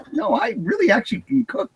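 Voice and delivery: mock protest. Retelling her reply to Catch's lunch refusal, high mock protest. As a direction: squeak it out as a hammed-up offended self. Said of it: "And I'm like".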